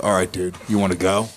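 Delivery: low-pitched fighting challenge; retold challenge. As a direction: drop low, squaring up for a fight. Retold challenge to approaching brother.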